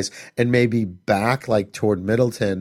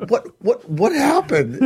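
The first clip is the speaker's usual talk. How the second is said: much higher, panicky repeated question; startled teen; 12 semitones higher than usual. Retold or imitated. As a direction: squeal it, rising in alarm. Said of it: "She's like".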